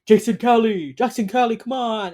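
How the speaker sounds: high, loud retold call; acted-out urgent hail. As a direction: call after someone who has turned away. Acting out calling to a man.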